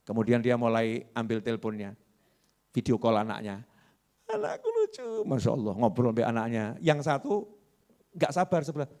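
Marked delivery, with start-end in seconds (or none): 4.3-5.3 cooing parent. Acted. Patient passenger cooing at child.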